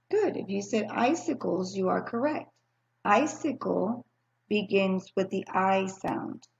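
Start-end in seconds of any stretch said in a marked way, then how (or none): 3.0-6.5 icicle drawn out slowly with a rising tune; slow teaching emphasis. Shown as a demonstration. Teacher to kindergarten class, slow clear emphasis. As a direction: stretch "Icicle" for the class to hear the I. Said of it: "helps us remember the I sound".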